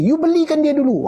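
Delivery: a high, bossy mock command; imitating the scolder. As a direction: squeak it up high like the bossy one. He voices the scolding elder.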